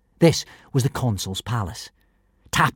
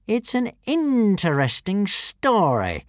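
slow, spat with fury; 9 semitones higher, slower than usual. A narrator's reading. Alvin furious at Hiccup, slow spat words. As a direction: bite each word with contempt. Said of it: "spat Alvin".

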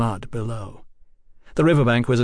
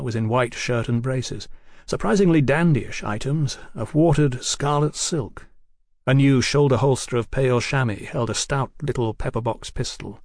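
ordinary delivery, nothing marked

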